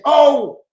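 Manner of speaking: loud angry oh; angry exclamation. Real, not acted. Vlogger exploding mid-rant, loud angry oh. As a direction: burst out in disgust.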